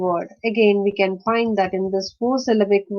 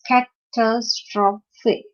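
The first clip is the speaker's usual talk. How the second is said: "catastrophe" slowly as ca-tas-tro-phe; syllable by syllable; much slower than usual. Shown as a demonstration. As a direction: break "catastrophe" into four parts. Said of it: "It's a four-syllable word".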